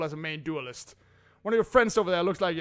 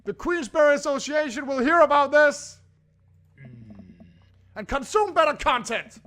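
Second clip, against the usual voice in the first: higher, louder call after them; 9 semitones higher, 7 dB louder than usual. Acted. Robert calling after the departing teens, loud and high. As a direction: shout after the leaving teens.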